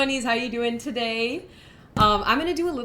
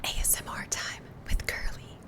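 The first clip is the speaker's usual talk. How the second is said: whispering. Real, not acted. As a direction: whisper gently for the ASMR stream. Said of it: "so I have to whisper now".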